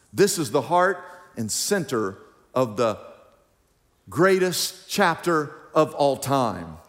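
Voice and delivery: usual speaking voice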